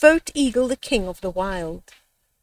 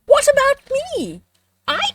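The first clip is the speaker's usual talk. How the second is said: much higher, pinched hyena cry; hyena calling out. Acted. Hyena calling out to the animals, high and eager. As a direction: squeak it up high from under the tree.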